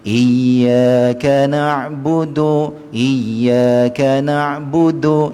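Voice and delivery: "Iyyaka na'budu" very slow, the yy held a moment; held tasydid. Shown as a demonstration. Teacher shows students how to hold the shadda. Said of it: "kena tahan dia sedikit".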